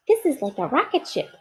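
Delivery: a toy bear marvelling at a new game; delighted little bear. Acted. Corduroy riding the elevator.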